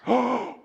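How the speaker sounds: mock scandalized gasp; shocked gasp. Crowd shocked at boy's words.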